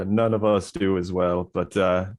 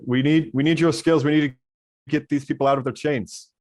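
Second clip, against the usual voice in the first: raised, urgent plea for help; raised urgent voice, higher than usual; urgent call; 5 semitones higher, faster than usual. Acted. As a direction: call out to the orc, pressing. Player character urges NPC.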